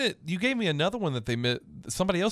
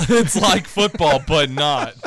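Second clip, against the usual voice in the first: commercial voice; 6 dB louder, much slower than usual. Pretend. Mock CFL commercial tagline.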